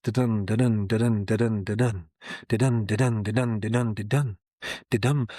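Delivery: steady chanted "da-dun" beats, weight on "dun"; iambic rhythm. Shown as a demonstration. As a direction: ride the rhythm like a trotting horse. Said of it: "It's a horse underneath the text".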